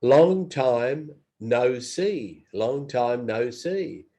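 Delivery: "Long time no see" drawn out slowly, word by word; slow and deliberate. Shown as a demonstration. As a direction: savour each word like a warm greeting. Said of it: "subject line".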